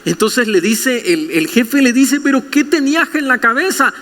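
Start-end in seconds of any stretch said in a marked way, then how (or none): 2.2-3.9 a high, mock-scolding boss; lightly voiced exasperated boss. Preacher voicing a boss catching a thief, mildly exasperated. Boss confronts thieving employee.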